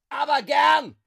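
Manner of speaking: very loud, high angry shout; bellowed retort. A narrator's reading. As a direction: roar it straight back. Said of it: "hatte Hans zurückgebrüllt". (zornig zurückgebrüllt)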